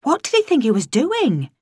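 high-pitched incredulity; appalled question. A narrator's reading. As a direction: ask in agitated disbelief. Lingos appalled at the ghost act.

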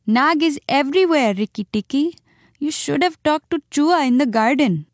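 high, timid muskrat voice; Chuchundar. A narrator's reading. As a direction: warn like a nervous little animal. Frightened muskrat warns the mongoose.

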